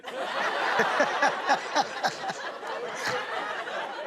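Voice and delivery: sinister chuckle through the speaker; evil laugh. Real, not acted. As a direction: laugh like a cartoon villain. Dad laughs after threat.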